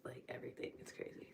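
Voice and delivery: hushed, trailing whisper. Real, not acted. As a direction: fade into a whisper. Trails off after the exercise.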